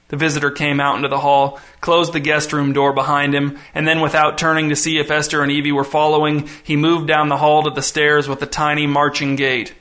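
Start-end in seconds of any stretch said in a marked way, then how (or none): none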